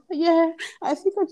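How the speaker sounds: high whimpering imitation; mock tearful. Girl answers her crush, mock tearful. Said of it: "And she's crying".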